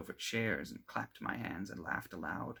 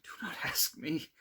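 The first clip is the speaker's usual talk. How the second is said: whispered in dread; frightened whisper; 7 semitones higher than usual. A narrator's reading. Victor hides his eyes from a friend, whispering in terror. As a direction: breathe it out in fear. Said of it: "cried I".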